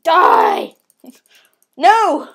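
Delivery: shrieking die; yelling. Real, not acted. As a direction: scream it, all out. Yelling at an enemy in game.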